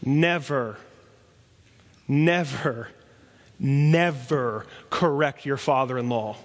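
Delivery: each never hit hard with a falling close; repeated never stressed. Shown as a demonstration. Preacher warning young men, never never never stressed. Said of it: "let me give you a piece of advice".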